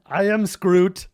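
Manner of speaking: hammed-up self-introduction; mock heroic declaration. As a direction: proclaim it like a pompous hero. Declaring self as new character.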